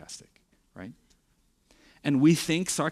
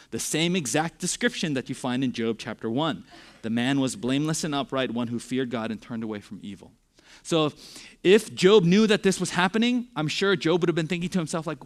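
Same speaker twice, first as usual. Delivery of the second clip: usual speaking voice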